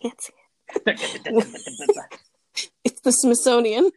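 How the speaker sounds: giggling through the words; can't stop laughing. Real, not acted. Giggling, fails to pronounce word.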